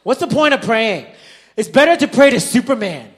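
very loud, high mock shout; hammed-up kid's tirade at full volume; in-your-face yelling. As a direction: imitate a child yelling in dad's face. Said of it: "Like, in my face".

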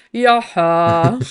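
loud, drawn-out meme "ja, ha"; Goretzka. Retold or imitated. Mimicking a footballer pestered for a photo, meme-style. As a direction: imitate the meme with a long held tail. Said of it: "So wie Goretzka. Kennst du dieses eine Meme?".

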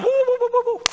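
very high falsetto woo-woo; mock magic woo. Preacher mimicking a showy healer's hooting, very high. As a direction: wave the jacket with silly hooting. Mocking a prophet's showy healing.

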